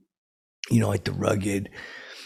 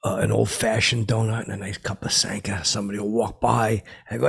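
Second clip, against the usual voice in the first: ordinary talk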